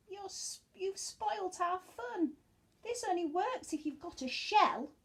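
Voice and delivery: mild snail complaint. Acted. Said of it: "they cried".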